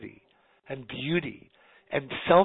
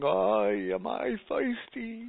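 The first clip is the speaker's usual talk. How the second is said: put-on New York accent; much slower than usual. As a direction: moan it like a thirsty New Yorker in the desert. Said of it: "as a New York accent".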